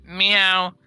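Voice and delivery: high, loud put-on cat meow; imitated meowing. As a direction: meow like someone playing a cat. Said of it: "he'll meow and just make really weird noises".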